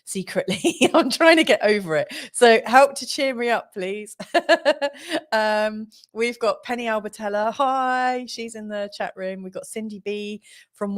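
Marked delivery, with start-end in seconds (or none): 4.2-5.3 embarrassed laugh. Real, not acted. Laughing at her own miffed confession.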